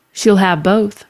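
plain reading voice

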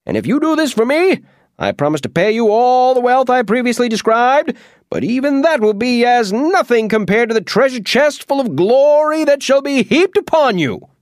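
Oz flattering. Acted. Wizard Oz buttering up the Munchkin, high flattering voice. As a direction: lay the flattery on thick and grand. Oz keeps persuading.

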